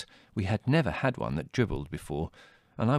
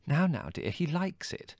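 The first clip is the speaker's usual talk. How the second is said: soft, high-pitched motherly soothing; placating; 10 semitones higher, 4 dB quieter than usual. A narrator's reading. Mother calming her wailing daughter, placating. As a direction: soothe gently in a light high voice. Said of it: "said Mother placatingly".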